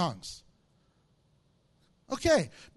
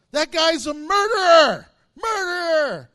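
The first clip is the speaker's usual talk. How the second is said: shouted, higher, accusing the stranger; mock accusing shout; 6 semitones higher, 10 dB louder than usual. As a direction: yell murderer like an angry crowd.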